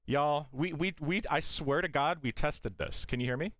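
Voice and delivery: usual speaking voice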